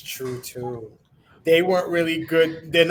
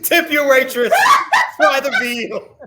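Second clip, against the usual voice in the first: laughing, hammed-up comedian sign-off; stand-up comic voice; 7 semitones higher, 5 dB louder, slower than usual. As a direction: deliver it like a lounge comic.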